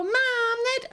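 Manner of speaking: put-on very high tattling kid voice; mock kid calling for mum; whining child. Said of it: "one of our kids being like".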